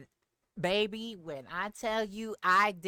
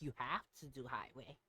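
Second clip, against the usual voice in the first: ordinary talk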